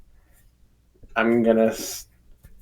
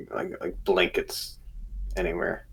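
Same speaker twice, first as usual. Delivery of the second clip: whispering; 3 semitones lower, 13 dB quieter than usual. Real, not acted. Player trails into whisper.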